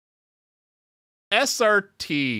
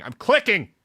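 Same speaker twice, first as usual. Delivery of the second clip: higher, louder I'm clicking; louder insisting; 5 semitones higher than usual. Real, not acted. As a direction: snap back I'm clicking.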